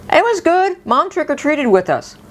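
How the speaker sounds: put-on high-pitched child reporting to dad; blurting son. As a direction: blurt it out like a kid telling on mom.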